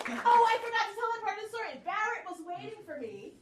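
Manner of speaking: high-pitched sudden remembering; giddy, high interjection; excited afterthought. Real, not acted. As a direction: blurt out the forgotten bit, squealing high. Suddenly remembers a missed story part.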